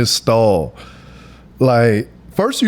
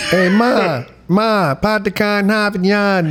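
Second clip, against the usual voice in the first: lightly mocked Boston accent, a bit higher; tossing off a half-hearted Boston accent; 6 semitones higher than usual. Host mocking his least favorite accent, light Boston accent. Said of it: "the second is Boston".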